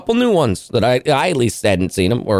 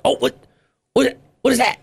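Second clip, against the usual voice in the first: high, curious pet voice; excited talking to dog; 11 semitones higher than usual. Retold or imitated. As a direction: point it out to the dog with delight. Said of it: "I was like".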